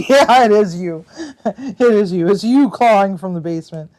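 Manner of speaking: loud, laughing taunt; laughing and teasing the viewer; laughing tease. Real, not acted. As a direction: call them out through laughter. Teasing a chat player.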